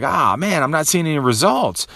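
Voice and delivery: ordinary talk, nothing marked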